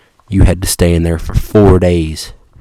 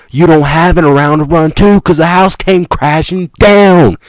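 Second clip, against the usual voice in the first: high, building to a loud finish; mounting exclamation; 8 semitones higher, 4 dB louder than usual. Acted. Storyteller to listener as the house collapses, worked-up exclamation. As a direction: get more worked up and land hard on the last word. Comic narrator escalating to a shout.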